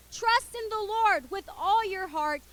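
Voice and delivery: slow, solemn scripture reading; verse recitation. Acted. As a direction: read the verse slowly and clearly.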